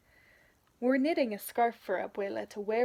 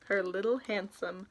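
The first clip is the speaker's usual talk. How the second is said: ordinary delivery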